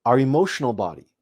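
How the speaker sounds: measured meditation voice, long pauses between phrases; guided meditation. Real, not acted. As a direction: guide listeners inward, phrase by unhurried phrase.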